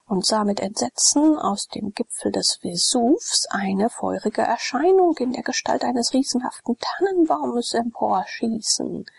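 usual speaking voice

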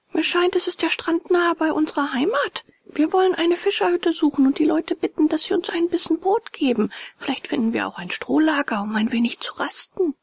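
put-on high, eager little boy's voice; a small boy offering help, pitched high; high boyish character voice; voiced puppet boy. A narrator's reading. Puppet boy answers his father.

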